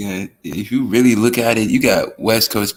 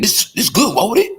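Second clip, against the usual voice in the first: high-pitched mock New Orleans eater; comic high New Orleans voice; New Orleans accent; 13 semitones higher than usual. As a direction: imitate a New Orleans noodle fan, pitched way up. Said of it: "Somebody eating Master P's".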